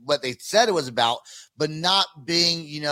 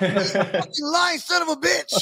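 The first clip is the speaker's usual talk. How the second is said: high, slow, laughing outburst; mock outrage; 11 semitones higher, slower than usual. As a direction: yell it in joking indignation. Joking outrage at the band.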